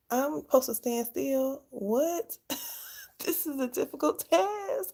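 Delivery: high, incredulous mock protest. New mom recalling being told to stand still, laughing high-pitched disbelief.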